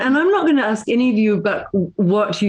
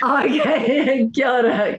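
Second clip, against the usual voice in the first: laughing greeting; 5 semitones higher, much slower than usual. Real, not acted. Laughs at caller's joke.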